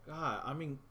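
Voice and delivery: ordinary delivery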